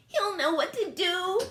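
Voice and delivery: very high eager alien voice; squeaky idea-girl; Bork crying out. A narrator's reading. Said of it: "cried Bork".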